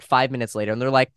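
ordinary talk

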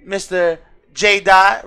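usual speaking voice

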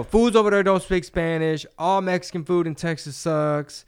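high, loud put-on voice of a know-it-all comic; mock outsider comic. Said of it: "you know".

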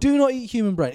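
loud, firm warning; emphatic loud warning. Acted. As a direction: hammer it home like a stern warning. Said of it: "emphatic warning, mock PSA".

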